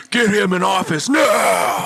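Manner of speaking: loud drawn-out mock demand; slow, shouted demand, half joking. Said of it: "they're like".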